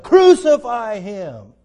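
the crowd calling for crucifixion, slow and stern; crowd's demand. Acted. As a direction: drag it out like an angry mob.

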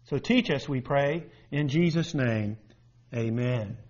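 soft, low prayer voice; quiet prayer. Real, not acted. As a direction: pray it gently, hushed. Opening prayer.

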